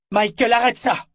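high, loud cry to stop him; shouting. Acted. Richard shouts at his brother to stop, loud and high. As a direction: yell it out in alarm. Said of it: "cria Richard".

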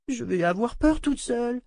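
put-on high child voice, timid; a girl afraid to be alone; frightened little girl. A narrator's reading. Rachel craint la solitude. (voix de fillette craintive)

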